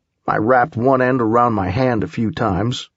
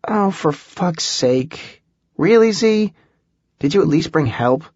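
exasperated; 6 semitones higher than usual. A narrator's reading. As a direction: groan it, fed up. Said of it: "Holly sighed".

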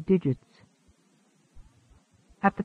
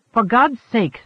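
mildly raised, higher exclamation; narrator's understated impatient outburst; impatient cry; 4 semitones higher, 4 dB louder than usual. A narrator's reading. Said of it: "Svoboda cried".

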